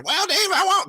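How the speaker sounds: very high, squeaky impression; Will Clark. Host mimicking his hero's reply, comically high-pitched voice. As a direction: pitch it way up in a mocking caricature. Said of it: "'cause that's how he talks".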